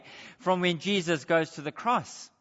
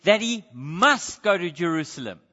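MUST punched, louder than the rest; emphasis on MUST; 3 semitones lower, 3 dB louder than usual. Shown as a demonstration. Preacher pointing out that he must go, stressed MUST. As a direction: lean hard on the word MUST. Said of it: "Notice I emphasize".

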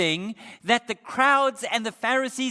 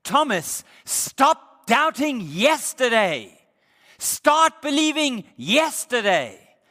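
urgent command as Jesus; 5 semitones higher, 6 dB louder than usual. Acted. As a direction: drive the command home like Jesus. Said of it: "Jesus is saying to him is".

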